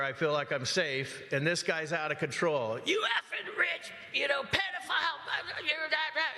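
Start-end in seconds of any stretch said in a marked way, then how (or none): 2.8-6.3 high, top-of-the-lungs yelled abuse, breaking into a laugh at the end; screaming insults. Retold or imitated. Stranger below the deck screaming insults at the homeowner. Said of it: "screaming on the top of his lungs".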